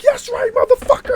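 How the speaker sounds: very high, loud shout; wild reenacted battle cry; triumphant attack yell. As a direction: holler it while charging at the enemy. Charging at squirrel with broom.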